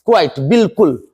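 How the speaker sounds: usual speaking voice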